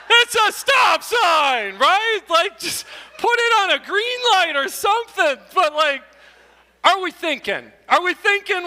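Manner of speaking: high, loud, disbelieving outburst; high loud exasperated disbelief; incredulous shout. Real, not acted. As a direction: shout it in mock disbelief at the sign. Exasperated at sign irony.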